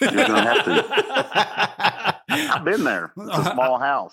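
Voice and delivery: slow, high laughing tease. Real, not acted. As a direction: drag it out, laughing. Teasing through laughter.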